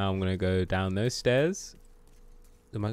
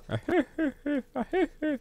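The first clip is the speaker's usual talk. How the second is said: high, rhythmic cartoon laugh; put-on laugh. Gamer capping a Shrek impression, put-on high laugh. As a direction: laugh it out high in repeated bursts.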